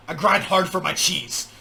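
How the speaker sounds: slow, heavy, punching every word; hard aggressive delivery. Retold or imitated. Mocking a rapper's hard line to the viewers, said slow and heavy. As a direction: lay it on hard like a tough rap line. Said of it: "Why'd you say it that hard?".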